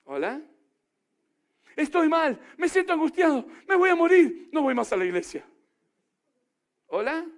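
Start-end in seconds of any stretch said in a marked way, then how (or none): 1.8-5.5 quejido agudo y fuerte que sube hasta el grito; creyente angustiado. Acted. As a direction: hace de creyente que se derrumba. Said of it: "imita al creyente angustiado". (creyente angustiado, agudo y cada vez más gritón)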